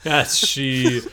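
usual speaking voice

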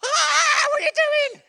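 very high, very loud shrieked cry; mock pained scream. Child to dad as salt stings, hammed-up shriek. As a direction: shriek it like a kid in sudden pain.

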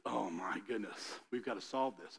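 low, hushed worry in a retold quote; quiet, understated panic; hushed dismay. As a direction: mutter it low, as if privately dismayed. Voicing David's worry over scandal.

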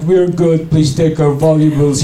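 ordinary delivery

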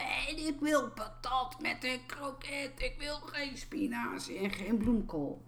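mild mock screaming girl; mock toddler tantrum. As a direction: imitate a three-year-old wailing, held back. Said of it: "begon keihard te schreeuwen en te gillen".

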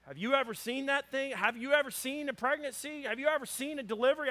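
high-pitched, incredulous grilling; mock insistent questioning. Hunting buddy grilling father-to-be, mock incredulous.

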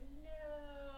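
retold cry of dismay, held long; mock dismay. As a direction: wail it in mock despair, stretched out. Mock dismay at show's sudden ending.

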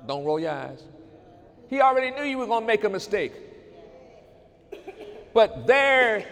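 rising to a fervent preaching cry; preaching at full force. Acted.

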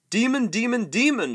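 high, loud shriek; panicked prince shrieking at Aslan, high and loud. Acted. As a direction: shriek it at the lion in terror. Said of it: "shrieked the prince".

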